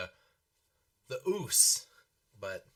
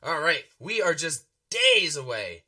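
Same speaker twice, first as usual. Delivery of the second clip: excited; 4 semitones higher, 8 dB louder than usual. Real, not acted. Hyping WrestleMania season.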